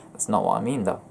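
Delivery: ordinary delivery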